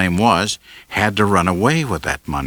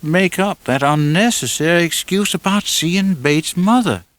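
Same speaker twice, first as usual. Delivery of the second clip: lightly voiced young woman asking, puzzled; Lila's voice; 11 semitones higher than usual. A narrator's reading. Narrator voices Lila questioning.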